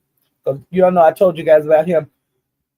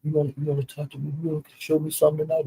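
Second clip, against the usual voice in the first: much softer, low flirty murmur; talking low; 10 dB quieter, faster than usual. Retold or imitated. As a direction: lean in and murmur it so no one else hears. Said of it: "they were kinda talking low to each other".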